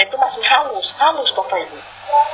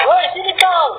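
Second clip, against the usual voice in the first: higher-pitched, coarse mock summons; imitated rough call; 9 semitones higher than usual. Preacher mimicking blunt Sulawesi folk summoning someone, rough mock call. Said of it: "Kasar memang cara ngomongnya".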